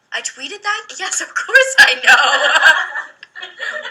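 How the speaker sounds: high, laughing disbelief at her own tweet; reading her own tweet back, laughing in disbelief. Real, not acted. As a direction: laugh through it, caught out. Surprised at own old tweet.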